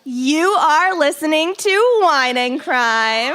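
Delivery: slow announcer intro; podcast intro. Acted. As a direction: roll out the show's opener. Show intro read.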